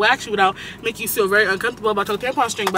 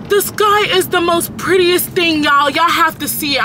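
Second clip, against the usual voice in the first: amazed; 6 semitones higher, 3 dB louder, slower than usual. Real, not acted. Amazed by the sky.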